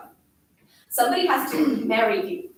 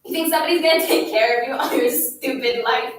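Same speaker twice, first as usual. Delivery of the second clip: loud, forceful berating; angry tirade; 8 dB louder than usual. A narrator's reading. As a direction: hammer the silent child with insults and questions. Bully's scolding tirade continues.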